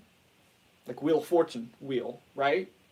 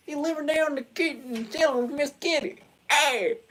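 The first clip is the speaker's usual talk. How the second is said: Goonie Bird; 10 semitones higher than usual. Retold or imitated. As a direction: holler it like a drunk animatronic bird. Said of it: "Goonie Bird was just".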